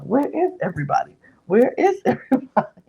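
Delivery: high, hammed-up calling out with a laugh; mock frantic search. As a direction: imitate someone frantically hunting for people. Acts out Brittany running through the house.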